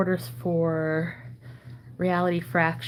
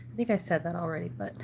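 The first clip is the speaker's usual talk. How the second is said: normal talking voice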